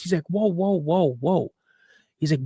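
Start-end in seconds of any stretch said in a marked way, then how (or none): 0.3-1.5 retold calming interjection. Dad tries to calm son.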